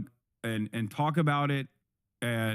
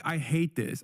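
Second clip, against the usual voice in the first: ordinary talk, nothing marked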